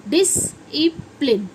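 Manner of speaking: Discipline broken into slow, separate pieces; syllable by syllable. Shown as a demonstration. Teacher breaking the word up for students, slow syllables. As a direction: sound out discipline slowly, piece by piece.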